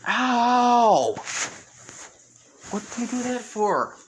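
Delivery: hurt, indignant ow; pained protest. Acted. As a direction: cry out from the chop and demand why. Izuku chopped on the head.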